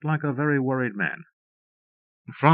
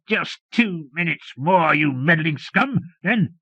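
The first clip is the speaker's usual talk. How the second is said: higher, slow, hateful yell; hoarse hateful yell; 7 semitones higher, slower than usual. A narrator's reading. Villain yelling at the meddling lieutenant, slow hateful high yell. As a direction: spit each word out slowly in hatred. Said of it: "In a voice hoarse with hatred".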